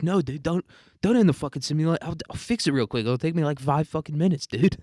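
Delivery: acted worried protest; alarmed pleading. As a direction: talk the boss out of it. Greg pleads against ending the simulation.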